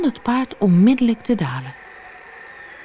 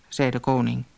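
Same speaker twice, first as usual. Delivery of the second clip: usual speaking voice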